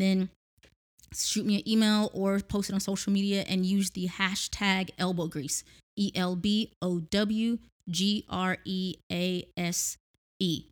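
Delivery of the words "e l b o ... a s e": each letter spoken slowly; spelling it out. Shown as a demonstration.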